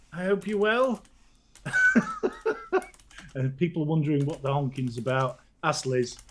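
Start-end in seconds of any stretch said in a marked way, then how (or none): none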